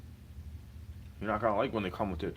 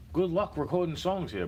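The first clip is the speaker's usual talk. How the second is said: sarcastic rant; 8 semitones higher, 4 dB louder than usual. Real, not acted. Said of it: "sarcastic rant".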